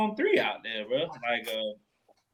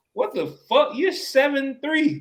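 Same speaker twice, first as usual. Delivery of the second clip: incredulous; 8 semitones higher than usual. Real, not acted. Mocking Gobert's punch.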